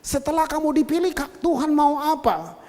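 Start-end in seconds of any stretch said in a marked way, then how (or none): none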